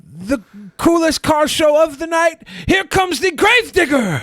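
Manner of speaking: booming hyped announcer voice, high; monster truck ad. Retold or imitated. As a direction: sell it like a monster truck rally. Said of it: "he's a fucking monster truck announcer".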